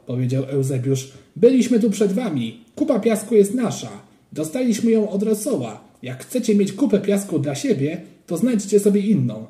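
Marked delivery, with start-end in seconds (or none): 1.4-9.5 put-on higher, insistent kid's voice; indignant child. A narrator's reading. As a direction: stand your ground like an indignant boy. Child defending sand pile. (oburzony dziecięcy głos)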